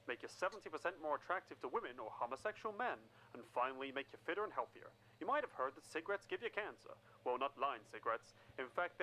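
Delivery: ad read. Acted. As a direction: sell it like a slick commercial. Cigarette ad read.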